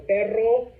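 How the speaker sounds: "perro" with a long trilled double R; rolled R in perro. Shown as a demonstration. Teacher models the Spanish word for dog, rolled R in "perro".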